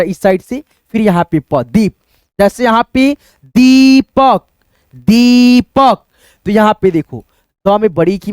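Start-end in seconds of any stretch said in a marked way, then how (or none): 2.4-4.4 दीपक said slowly with the ई held long; drawn-out ee. Shown as a demonstration. Teacher stretching the long vowel in दीपक for students. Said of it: "खींचता हुआ". 4.9-6.0 दीपक with its ई held very long, much slower and louder; very drawn-out ee. Shown as a demonstration. Teacher exaggerating the long ई in दीपक for students. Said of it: "खींचता हुआ".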